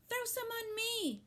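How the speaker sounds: put-on high, eager boy's cry; boy wanting water thrown on him. A narrator's reading. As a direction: beg for it gleefully. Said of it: "cried Avery".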